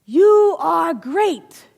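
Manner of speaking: slow drawn-out yell; echoed praise. Acted. Mountain echoing praise, slow and loud. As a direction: stretch it out like an echo. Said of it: "yells back the mountain".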